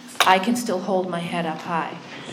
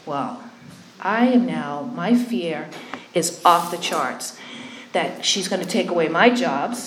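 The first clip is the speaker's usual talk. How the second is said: ordinary talk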